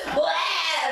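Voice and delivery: laughing mock retch; imitated retching. Mother retelling throwing up holding the baby, mock retch. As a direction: fake a gagging bleh. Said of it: "and I'm like".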